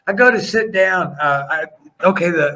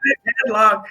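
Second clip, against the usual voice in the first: urgent; 6 semitones higher, faster than usual. Retold or imitated. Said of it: "I said".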